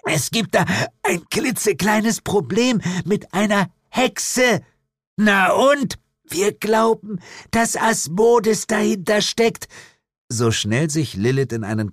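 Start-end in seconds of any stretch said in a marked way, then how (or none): 0.0-4.6 high, effortful, halting plea; strained under crushing. Acted. Jane still crushed by tentacles. 5.2-5.9 high, impatient retort; dismissive demon mother. Acted. 6.3-9.7 high, slow, effortful voice; strained under crushing. Acted. Jane still crushed by tentacles.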